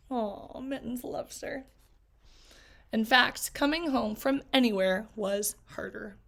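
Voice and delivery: ordinary delivery, nothing marked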